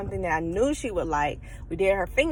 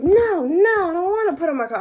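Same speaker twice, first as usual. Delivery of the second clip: put-on high, whining no no from a child; whiny child; 8 semitones higher than usual. Daughter refusing her coat in the car, whiny child. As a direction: whine like a stubborn little girl. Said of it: "She was like".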